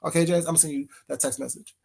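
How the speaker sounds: ordinary delivery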